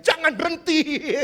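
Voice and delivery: high drawn-out pretend yell; mock scream. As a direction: draw the shout out for the joke. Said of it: "saya langsung teriak".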